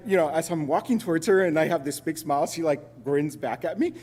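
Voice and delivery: ordinary talk, nothing marked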